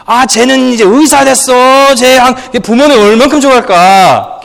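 high, fast gossiping Korean parent voice; imitating Korean parents' talk. Pastor mimics Korean parents bragging, high and fast. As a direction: rattle it off like a proud Korean mother. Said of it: "and they say".

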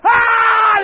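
high, loud scream; patient's yell. Retold or imitated. Patient flipping out at the guards, loud scream. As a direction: flip out in an imitated scream. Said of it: "Sam flips out".

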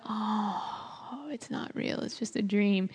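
hushed, deflated realisation; crushed whisper. Retold or imitated. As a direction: breathe it out in defeat. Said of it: "it would just be so crushing".

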